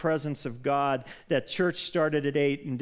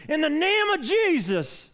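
high, loud pulpit shout; preacher's yell; 12 semitones higher, 4 dB louder than usual. Retold or imitated. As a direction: bellow it like a fiery preacher. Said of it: "'cause you have to say it like that".

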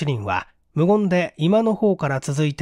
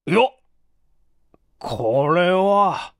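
a sharp higher yelp, then a very slow drawn-out これは; stunned by what is in the card case; dumbfounded; 3 semitones higher, much slower than usual. Acted. Inspector finding the strange business cards, taken aback and drawn out. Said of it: "呆れたような顔をした".

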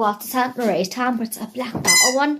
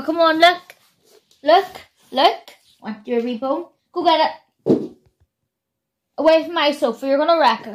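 coaxing the dog; 6 semitones higher, 3 dB louder than usual. Real, not acted.

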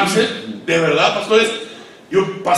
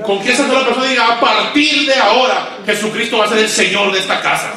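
full-force preaching; 5 semitones higher than usual. Acted. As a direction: preach it out to the pastors.

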